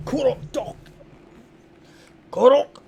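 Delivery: loud, high guttural orc cry; orc shout. Acted. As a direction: call out like a wild orc. GM voices the orc calling.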